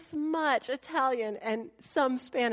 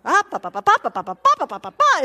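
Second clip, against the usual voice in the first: loud repeated yell, climbing higher; mock yelling; 3 semitones higher, 9 dB louder, faster than usual. Old Italian neighbor yelling at the family, loud. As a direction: bark it out like a scolding neighbor. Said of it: "she's yelling at us too".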